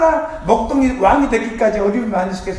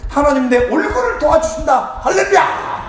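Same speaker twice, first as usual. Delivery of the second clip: preaching shout; 5 semitones higher than usual. Real, not acted. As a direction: rouse the congregation. Preacher's climax.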